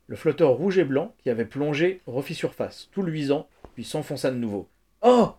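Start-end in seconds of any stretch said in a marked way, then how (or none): 5.0-5.3 high, loud burst of excitement; choked cry. A narrator's reading. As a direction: cry out suddenly, throat tight. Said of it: "cria Danny, la gorge serrée".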